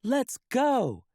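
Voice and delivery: rally everyone to go; eager cheer. Acted. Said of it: "says First Mate Callie".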